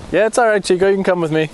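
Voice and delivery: coaxing a dog. Real, not acted. Coaxing the dog Chico to come with him, high and sweet. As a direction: invite the dog along sweetly.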